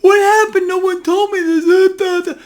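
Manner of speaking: hammed-up blubbering of someone who just lost everything; shrill mock-sob parody of a duped saver; mock crying investor. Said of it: "then they weep and then they cry".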